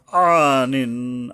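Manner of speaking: "আনিন" drawn out slowly from a throat ayn; ayn demonstration. Shown as a demonstration. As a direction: recite "আনিন" with a long held first vowel. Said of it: "আবার আইন".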